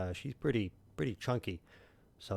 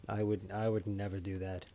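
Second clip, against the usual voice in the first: ordinary delivery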